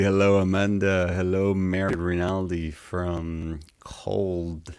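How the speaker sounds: usual speaking voice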